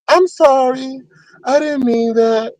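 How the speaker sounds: a hint of a timid backpedal, slow and high; mock meek apology. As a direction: lightly imitate him backing down. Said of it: "he go from".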